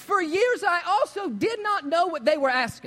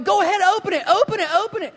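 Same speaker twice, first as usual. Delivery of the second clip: imitating an eager dad, higher and louder; 6 semitones higher, 5 dB louder, faster than usual. Dad urging his son to open the box, eager. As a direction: egg him on, grinning. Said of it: "his dad's like".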